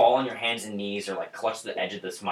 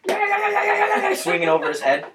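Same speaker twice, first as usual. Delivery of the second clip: excited squeal; 6 dB louder than usual. Acted. GM acts rat swinging sword.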